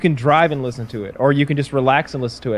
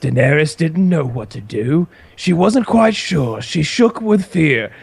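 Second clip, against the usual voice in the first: audiobook narrator; 2 semitones higher than usual. Retold or imitated. Imitating the audiobook narrator reading a Daenerys chapter, theatrical narration. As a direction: imitate a dramatic narrator, measured and drawn out. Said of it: "so oftentimes he'll say".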